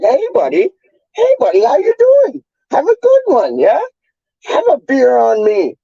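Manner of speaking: squeaky, high-pitched Canadian parody; mock Canadian. Streamer mocking a local offering a beer, exaggerated Canadian falsetto. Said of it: "That's how they talk down here".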